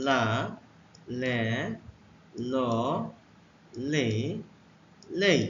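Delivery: teacher modelling লা লে ল লে লেই; letter sounds demo. Shown as a demonstration. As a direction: model লা লে ল লে লেই with a pause after each. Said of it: "এল এর উচ্চারণ হবে".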